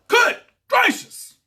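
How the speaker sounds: very high, loud, slow gasp of joy; excited exclamation. Real, not acted. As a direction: savor the win. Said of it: "I just got excited".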